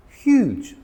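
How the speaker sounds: "Huge" said high and stretched to sound big; emphatic big word. Shown as a demonstration. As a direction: make "Huge" sound enormous. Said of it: "Huge means very big".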